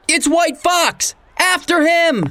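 excited shout. Acted. Sheriff spots the fox.

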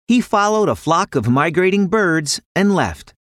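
usual speaking voice

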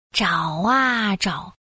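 drawn-out, slow refrain; slow searching. A narrator's reading. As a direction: stretch out the search.

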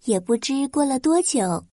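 ordinary delivery

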